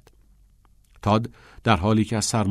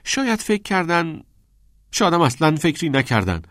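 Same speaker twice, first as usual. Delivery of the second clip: high, bitter echo building louder; raised, embittered retort; 8 semitones higher, 4 dB louder than usual. A narrator's reading. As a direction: throw the suggestion back with rising resentment. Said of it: "بعد از مکسی طولانی و تلخ گفت".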